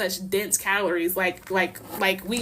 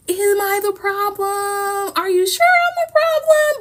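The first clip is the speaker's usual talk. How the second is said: mocking innocence; 10 semitones higher, 4 dB louder, slower than usual. Mocking systems that deny blame.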